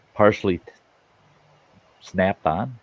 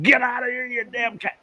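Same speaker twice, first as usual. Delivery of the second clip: mock yell, pitched way up; retold yelling; 13 semitones higher, 4 dB quieter than usual. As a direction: shoo off a pest in a high voice. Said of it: "I'm kicking and yelling".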